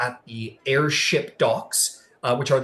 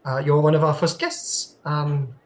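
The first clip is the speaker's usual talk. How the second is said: a lightly put-on French accent, polite host; 4 semitones higher than usual. Greeter welcoming Cross aboard, lightly French-accented. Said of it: "I keep turning into a French person".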